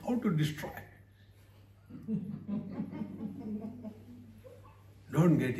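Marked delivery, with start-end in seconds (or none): 1.9-3.9 laugh. Real, not acted. Audience laughter after joke.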